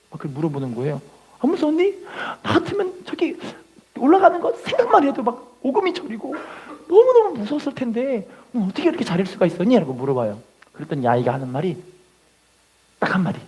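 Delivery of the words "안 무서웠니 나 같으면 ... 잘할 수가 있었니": much higher, wondering question; amazed bystander. Retold or imitated. A preacher voicing an amazed onlooker questioning the child, much higher. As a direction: ask in awe and disbelief. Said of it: "사람들이 물어봅니다".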